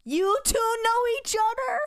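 high, loud, shocked question. Acted. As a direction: gasp it out in disbelief. Acted surprise in the scene.

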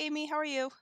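ordinary talk, nothing marked

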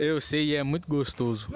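low mock-sexy voice; sensual. Redoing the sexy line for friends, sensual. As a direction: drop the pitch and play seductive. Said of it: "do jeito sensual".

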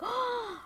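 quick high gasp of shock; sharp gasp. Retold or imitated. Hearing the singer died, a startled gasp. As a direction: suck in air like hearing bad news. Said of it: "I was like".

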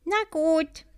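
high, friendly agreement; a kind honey carrier answering the little bee. A narrator's reading. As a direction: say it kindly, lifting up. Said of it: "sagte diese freundlich".

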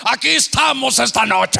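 loud, high, ringing prayer cry; a preacher's bellowing prayer voice; booming prayer shout. Retold or imitated. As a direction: shout the prayer to drown everyone out. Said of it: "Y él".

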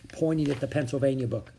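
usual speaking voice

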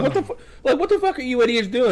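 high-pitched, amused disbelief; squeaky-high retold exasperation; incredulous scolding. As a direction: imitate yourself berating them, half laughing. Retold scolding of dodging deputies.

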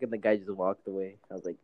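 ordinary delivery

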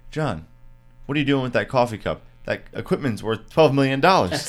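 loud, high, fast mock rant; hammed-up angry boss yelling; mock angry outburst. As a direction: yell at him like a furious host. Mock yelling at crew, impression.

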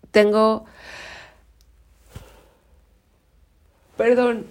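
talking through a yawn; mid-yawn, words stretched. Real, not acted. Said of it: "no sé por qué estoy bostezando".

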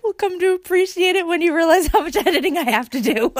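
talking through real laughter; giggling through the words; laughing. Real, not acted. Laughing while talking about podcast editing.

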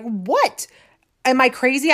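incredulous. Retold or imitated. Reacting to friend's laughter.

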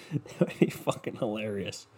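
ordinary talk, nothing marked

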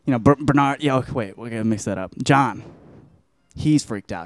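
ordinary delivery

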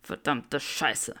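slow, low, muttered swearing; low curse. A narrator's reading. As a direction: curse it under breath. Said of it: "fluchte Draco".

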